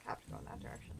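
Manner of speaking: whispered aside to himself; whispering. Real, not acted. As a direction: say it under your breath. Gamer muttering to himself.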